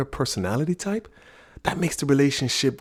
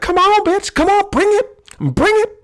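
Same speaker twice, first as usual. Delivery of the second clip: high, loud taunt, much higher and louder; mock taunt; 7 dB louder than usual. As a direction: goad her loudly in a high voice. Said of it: "my wife is just sitting there like".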